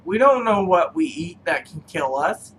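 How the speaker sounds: ordinary talk, nothing marked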